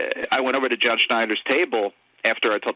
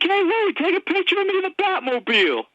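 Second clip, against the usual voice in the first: much higher, squealing imitation of an eager actor; excited celebrity. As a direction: ham up the eager fan-favourite star begging for a photo. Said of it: "he's like".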